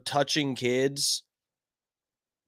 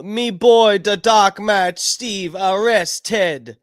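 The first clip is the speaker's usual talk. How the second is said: mock British tabloid voice, louder and slow; hammed-up accent reading a headline; 3 semitones higher, 5 dB louder, much slower than usual. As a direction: read the headline in a put-on accent. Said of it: "read it in that accent".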